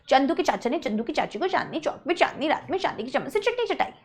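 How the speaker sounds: quick, hushed tongue-twister patter; rapid low-volume recitation. Shown as a demonstration. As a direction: rattle it off fast and softly.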